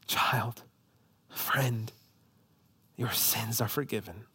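very soft, low, near-whispered assurance; tender hush. Acted. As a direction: speak it gently, word by word. Jesus tenderly addressing the believer.

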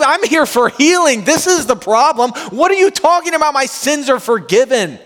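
high, strained disbelieving voice; paralytic protesting. Retold or imitated. Paralytic on the mat protesting to Jesus, high and disbelieving. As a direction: plead that he came for healing.

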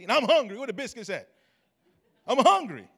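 a touch of mock hangry whine. Preacher playing a hangry churchgoer, mock whiny. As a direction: pout it like a hungry kid. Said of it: "we get hangry".

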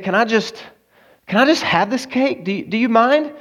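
halting, hopeful plea pitched high; pleading woman. Retold or imitated. Agnes asks to keep her birthday cake, pleading.

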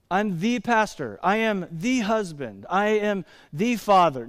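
slow mock-grand titles, each "the" drawn out as "thee"; pompously single out "the" before each title; stress on THE. Shown as a demonstration. Pastor parodying self-importance, "the" heavily stressed. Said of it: "I don't use the definite article".

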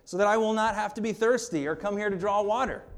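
lightly voiced keen woman; eager plea. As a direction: beg for it keenly. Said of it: "And then she says".

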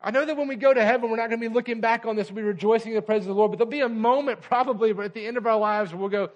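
fast, loud, high sermon drive; full-force preaching. Acted. Preacher urging the church, fast and loud.

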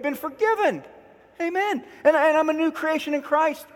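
high, hammed-up confident self-talk; mock pep talk to self. Preacher acting out talking back to himself, hammed-up upbeat. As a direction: cheer yourself on with truth.

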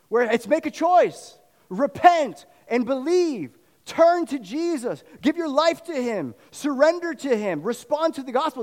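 high, loud, slow gospel appeal; fervent altar-call preaching; preaching at full force. Acted. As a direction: plead with the crowd to turn to Jesus.

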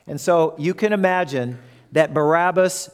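normal talking voice

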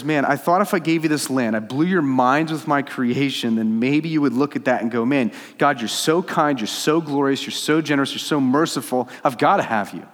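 impassioned imagined plea; eager longing. Acted. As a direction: pile up the praise with mounting enthusiasm. Acts God longing to be wanted.

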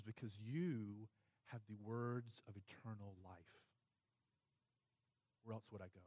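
quiet, moved declaration of faith; reverent confession. Retold or imitated. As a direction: say it softly and reverently, leaving pauses. Peter's reverent confession to Jesus.

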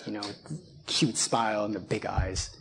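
usual speaking voice

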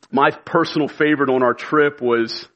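ordinary delivery

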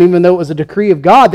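usual speaking voice

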